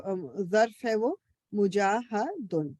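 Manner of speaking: ordinary talk, nothing marked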